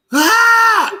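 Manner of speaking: high, loud mock scream; imitated night scream. Imitating someone waking up screaming at night, mock scream. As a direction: scream suddenly as if startled from sleep. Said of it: "getting up, hollering and screaming at night".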